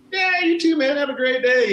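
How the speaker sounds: very high, loud friendly holler; cheery call-back. Retold or imitated. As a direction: call out a sunny goodbye to a passing driver. Cheerful reply called to a driver.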